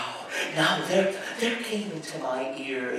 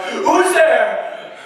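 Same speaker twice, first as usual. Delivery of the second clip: high, loud startled cry in the dark; old man jolting awake and crying out into the dark; frightened cry; 11 semitones higher, 10 dB louder than usual. Acted. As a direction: shout it out in terror. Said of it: "cried out".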